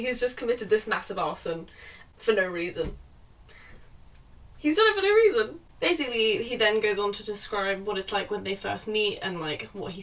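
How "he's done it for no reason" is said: higher, fast, disbelieving; incredulous exasperation. Real, not acted. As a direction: spit it out, fed up. Ranting about the plot.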